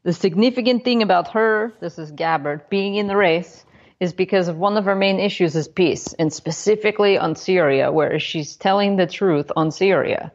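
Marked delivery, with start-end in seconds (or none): 0.0-1.7 mock Russian accent. Host reads colleague's quote in mock Russian accent. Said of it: "like I was just speaking". 2.7-10.3 mock Russian accent. Host reads colleague's quote in mock Russian accent. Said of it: "like I was just speaking".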